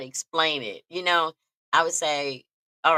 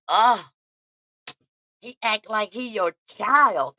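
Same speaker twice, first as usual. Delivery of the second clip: mock aunt; 5 semitones higher, 3 dB louder, slower than usual. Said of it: "she was like".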